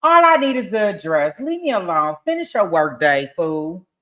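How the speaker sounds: loud, emphatic mock brush-off; acted dismissiveness. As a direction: read it out like telling a fool to get lost. Commentator re-reads dismissive text loudly.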